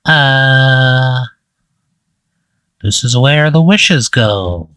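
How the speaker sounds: drawn-out creature voice, slowly intoned; slow, otherworldly creature stretching out each word; wish creature. Acted. Game master voicing the creature's answer to player, slow creature voice. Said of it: "And it says".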